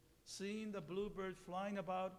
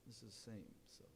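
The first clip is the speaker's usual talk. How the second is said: plain reading voice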